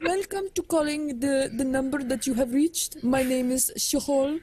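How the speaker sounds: put-on Indian accent call-centre agent; call-centre greeting, Indian accent. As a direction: play a helpline worker in a mock Indian accent. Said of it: "Can you do an Indian accent?".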